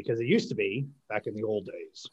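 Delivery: lightly put-on old man voice; old guy voice. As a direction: slip into a hint of an old-timer. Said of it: "look at my old, old guy voice".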